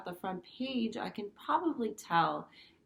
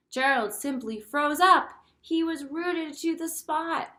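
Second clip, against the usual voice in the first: higher, louder dramatic read-aloud; dramatic storybook reading; 7 semitones higher, 8 dB louder than usual. Acted. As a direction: build the tension for the kids. Teacher reads picture book aloud.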